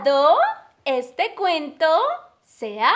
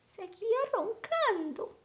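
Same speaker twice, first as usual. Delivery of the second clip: hushed, trailing sleepy line; soft; 4 semitones higher, 12 dB quieter than usual. A narrator's reading. Narrator telling the dog kept snoring, soft. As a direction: let it sink to near silence.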